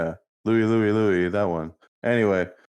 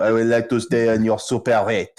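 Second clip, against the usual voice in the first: put-on French accent. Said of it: "giving Malcolm a French accent".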